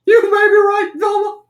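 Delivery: very high, loud cartoon cry; Shaggy. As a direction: squeal it like a goofy cartoon slacker. Acted Shaggy reply to Velma.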